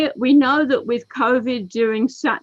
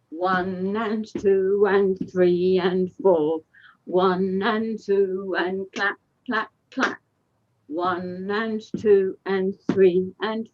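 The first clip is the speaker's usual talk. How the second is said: slow counted call; slower than usual. Shown as a demonstration. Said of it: "teacher calls the dance count".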